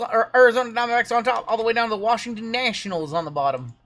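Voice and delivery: loud cowboy auctioneer call. Pretend. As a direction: rattle it off like an auctioneer. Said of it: "Starting to sound like an auctioneer there".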